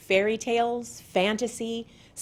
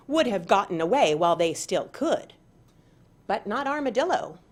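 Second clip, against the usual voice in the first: plain reading voice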